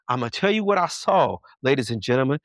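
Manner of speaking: usual speaking voice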